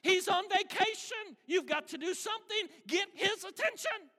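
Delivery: high, loud, taunting shout; sarcastic goading. Acted. Elijah urges the prophets to get their god's attention, taunting loudly. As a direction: egg them on at full pitch. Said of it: "shout a little bit louder".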